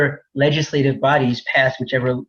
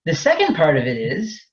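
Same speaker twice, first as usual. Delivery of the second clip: ordinary talk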